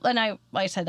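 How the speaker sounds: normal talking voice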